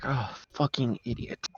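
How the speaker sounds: quiet, posh muttered insult. Acted. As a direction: sneer it in a posh voice. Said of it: "the posh voice be like".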